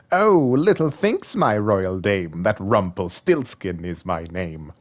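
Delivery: slow, gloating fairy-tale villain. Acted. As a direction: savour each word like a sneaky villain. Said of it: "It's my villain voice".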